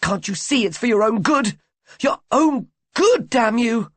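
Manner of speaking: high, loud shrieking fury, stretching your own good. A narrator's reading. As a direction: scream it at him in a rage. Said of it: "in a fury".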